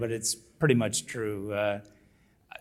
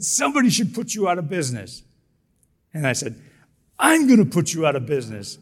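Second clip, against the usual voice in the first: louder, higher furious threat; angry threat; 5 semitones higher, 7 dB louder than usual. Retold or imitated. An angry customer threatens the manager, louder and higher. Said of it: "while I'm screaming".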